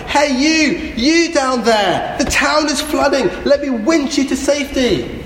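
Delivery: high-pitched hail from a helicopter; megaphone shout. Acted. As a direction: call down as if through a megaphone. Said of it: "the guy with a megaphone sh-, shouted".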